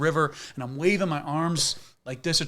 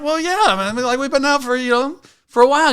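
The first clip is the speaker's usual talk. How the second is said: sheepish shouted reply; 12 semitones higher, 8 dB louder than usual. Retold or imitated. Own sheepish reply, voiced loud.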